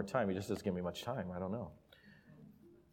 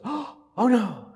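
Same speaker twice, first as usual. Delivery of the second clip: put-on high, loud little-girl "oh no" after a gasp; gasp, then cry it like a worried toddler; little girl's dismay; 13 semitones higher, 8 dB louder than usual.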